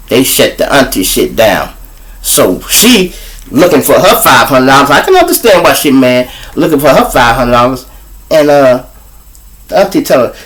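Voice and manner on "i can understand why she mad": usual speaking voice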